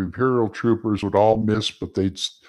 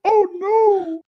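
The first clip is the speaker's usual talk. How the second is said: mock wail; 4 dB louder than usual. Dismay at crossover idea.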